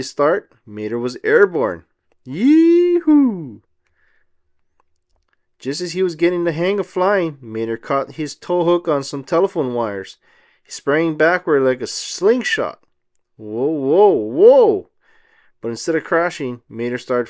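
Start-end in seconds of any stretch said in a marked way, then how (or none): 2.2-3.6 high, loud yee-hoo; whooping with glee in flight; joyful whoop. Acted. 13.4-14.9 startled whoa. Acted. Mater flung backward.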